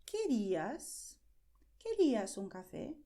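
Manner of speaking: slow, clear friendly question; slow friendly offer. Shown as a demonstration. Teacher modelling a friendly offer, slow and clear. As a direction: offer the coffee gently and slowly. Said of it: "you can ask".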